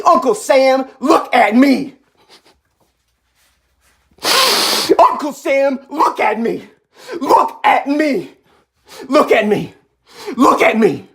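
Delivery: high-pitched, insistent callout to Uncle Sam; impassioned demand. Real, not acted. Defiant address to Uncle Sam.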